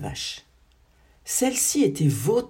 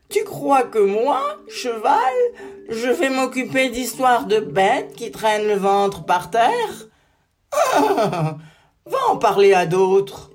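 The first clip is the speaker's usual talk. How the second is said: higher, mocking laughing horse; scornful horse; 5 semitones higher, 4 dB louder, slower than usual. A narrator's reading. Proud horse mocking the salamander, laughing scornfully. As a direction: sneer and laugh through it.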